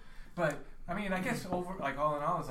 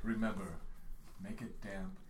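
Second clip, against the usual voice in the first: low plant voice; 10 semitones lower, 6 dB quieter than usual. Acted.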